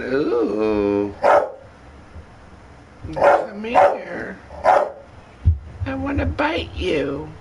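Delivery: high, creepy, slow snake voice. Acted. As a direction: tempt them like a sly snake. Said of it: "The rotten snake saying".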